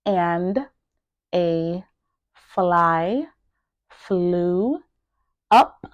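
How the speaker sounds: each word isolated by a pause. Shown as a demonstration. Teacher drilling each word for learners to repeat, word by word. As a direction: say one word, pause, then the next. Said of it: "make sure that you are pronouncing each word".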